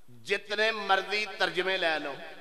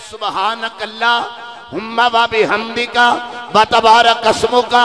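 slow recited prayer; 11 dB louder, slower than usual. Acted.

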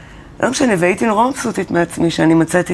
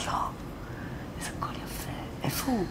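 hushed, breathy wonder at a lost sound; awed whisper; 4 semitones higher, 17 dB quieter, faster than usual. Retold or imitated. Singer hearing her old voice on the radio, awed whisper. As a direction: whisper it like you've found a treasure.

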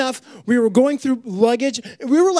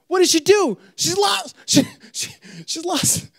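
rising excitement; 7 semitones higher than usual. Acted. Dramatic rising build.

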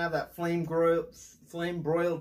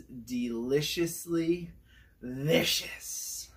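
drawn-out catchphrase; much slower than usual. Pretend. Host stamping the burger with his show catchphrase, slow and savoring. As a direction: draw out "deliciously vicious" like a grand verdict.

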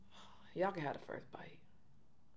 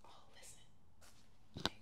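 hushed whisper; 13 dB quieter, much slower than usual. Real, not acted. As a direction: whisper it softly. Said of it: "whisper heard".

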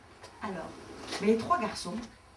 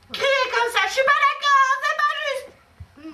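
shrill high wail, much louder, dragged out slowly; high crying shout; 11 dB louder, much slower than usual. Shown as a demonstration. Teacher demonstrating a crying girl's loud protest. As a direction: show the class a child shouting and wailing in protest.

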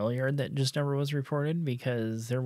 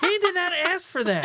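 laughing; 5 dB louder than usual. Real, not acted. Laughing at Sarah's spank.

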